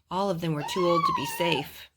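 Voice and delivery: usual speaking voice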